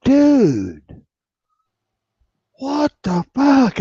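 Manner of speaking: shocked yell. Retold or imitated. Cop friend reacting to the accusation, stunned yell. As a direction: yell it like you can't believe it.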